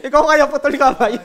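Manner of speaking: punchline forced out through real laughter, high and loud; laughing. Real, not acted. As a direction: giggle the line out.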